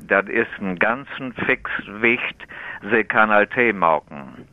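East Frisian Platt posed as a riddle; East Frisian Low German. Shown as a demonstration. Tea expert sets the host a riddle, East Frisian Low German. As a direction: recite the East Frisian dialect line. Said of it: "wenn ich Ihnen sage".

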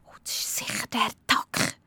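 whispered, nervous; frightened whisper; whispered thought. A narrator's reading. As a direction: breathe it to yourself in fear. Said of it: "denkt Rosi".

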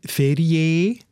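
"Ferie" with a full, stressed long E at the end; "Ferie" ending on a strong closed "ee"; strong final E. Shown as a demonstration. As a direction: say "Ferie" as "feri-EE", slowly. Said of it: "man kann das nicht so stark machen".

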